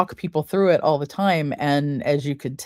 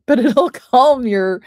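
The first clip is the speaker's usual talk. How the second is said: laughing; 8 semitones higher, 7 dB louder than usual. Real, not acted. Laughs at her own hiccup.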